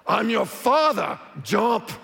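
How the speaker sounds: frustrated dad doing a hammed-up Darth Vader. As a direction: boom it like a movie villain dad. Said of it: "your Darth Vader voice".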